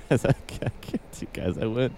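whispered through laughter; can barely speak for laughing; breathless laughter. Real, not acted.

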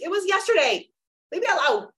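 high-pitched mock exasperation; comic fed-up comeback; exasperated retort. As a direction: fend them off in a high, whiny protest. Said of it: "And I'm like".

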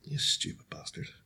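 harsh whisper at his own reflection; whispered self-reproach. Real, not acted. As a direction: spit it in a whisper to the mirror. Glaring at himself in mirror.

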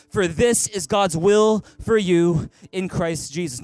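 full-voiced congregational reading; big loud voice. Real, not acted. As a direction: boom it out with the whole room. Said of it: "Read with me, big loud voice".

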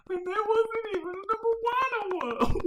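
very high, loud mock-drunk lament; hammed-up drunken whine; drunken wailing. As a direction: wail it like a drunk in despair. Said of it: "drunk, going".

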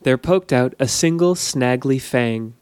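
ordinary delivery, nothing marked

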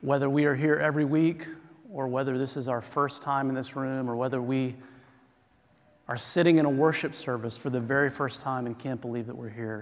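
low, soft congregational prayer; hushed, reverent petition; opening prayer. Real, not acted. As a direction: pray quietly and evenly on everyone's behalf.

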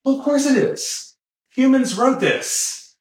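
high, pointed retort, as if stating the obvious; emphatic mock retort. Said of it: "So someone says, like".

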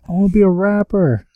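high mock girl voice; young girl voice. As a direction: play a dazed young girl. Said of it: "She's like".

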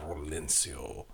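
Rolencio said slow and soft with a Spanish accent, rolled R. Shown as a demonstration. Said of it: "you have to say with a very nice Spanish accent".

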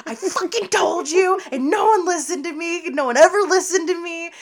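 high-pitched indignant mock outburst; imitating a vindicated rant; vindicated sister. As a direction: protest it as a wronged little sister finally believed. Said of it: "so vindicated".

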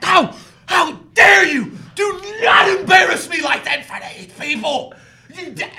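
hammed-up mortified mother telling off her kid; embarrassed mother scolds her son, hammed-up high shout; mock scolding mother. Mother scolds son in front of guests.

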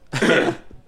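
real cough from choking on popcorn; sharp cough clearing the throat; coughing fit. Real, not acted. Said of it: "I'm choking on popcorn".

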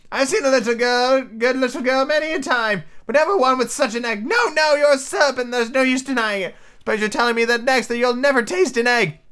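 very high, insistent bird voice; scornful pigeon. Acted. Pigeon calling Alice a serpent, high and scornful. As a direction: scold in a high squawk.

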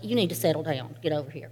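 a hint of a gruff grandfather settling a child; stern grandpa. Grandfather settling a worked-up child, low and firm.

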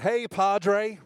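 loud, high call across the shop. Retold or imitated. Atheist regular greeting the pastor across Starbucks, loud call. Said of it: "calls out".

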